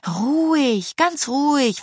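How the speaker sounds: high, drawn-out soothing voice; calm a nervous horse. A narrator's reading. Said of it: "ihre Haflingerstute zu beruhigen".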